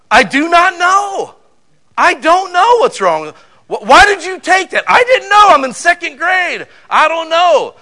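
put-on high, loud, slow child's wail; whiny little kid. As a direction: whine it like a helpless second grader. Little boy pleading with mom.

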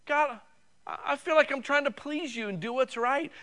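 high, whiny plea to God; complaining prayer. Retold or imitated. Believer complaining to God about his life, high and whiny. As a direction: whine it up at God like a hurt believer. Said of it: "you say".

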